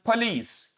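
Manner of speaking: फलीस with aspirated p, 'phuh-LEES'; puff of air. Shown as a demonstration. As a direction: model फलीस with a breath burst on the first sound. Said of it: "with a puff of air बोला जाता है". (फलीस, हवा के झोंके वाला 'प')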